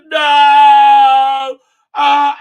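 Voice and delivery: loud, high cry of "No!"; hammed-up anguished yell; mock shout. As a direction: belt out a high dramatic "No!". Retelling explosion, character cries out.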